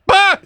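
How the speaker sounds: high loud startled bah; mock outburst. Hearing warsh in church, a high loud bah bursts out. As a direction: blurt a sudden high bah.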